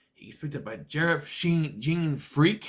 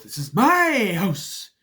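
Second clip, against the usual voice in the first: slow, loud, higher hammed-up witch; overacted line; 6 semitones higher, 7 dB louder, slower than usual. Reviewer mocking the witch's overacting, slow and loud. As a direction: drag it out like a ham actor. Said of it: "the house of overacting".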